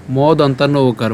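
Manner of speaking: ordinary delivery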